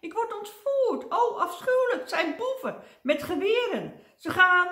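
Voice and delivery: very high, loud hysterical wail; hammed-up squeals of terror, breaking off abruptly; panicked screaming. A narrator's reading. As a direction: shriek as if being carried off. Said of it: "gilde hij".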